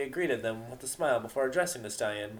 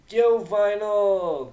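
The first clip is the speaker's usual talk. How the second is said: loud, drawn-out call; raised voice; 10 semitones higher, 9 dB louder, much slower than usual. A narrator's reading. Earth pony calling to Vinyl across the room, loud. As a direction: call out across the crowded hall. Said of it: "said with a raised voice".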